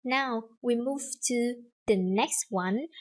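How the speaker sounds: usual speaking voice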